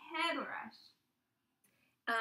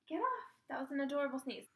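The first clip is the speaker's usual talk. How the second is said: normal talking voice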